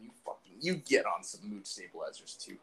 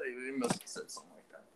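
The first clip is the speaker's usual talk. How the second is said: usual speaking voice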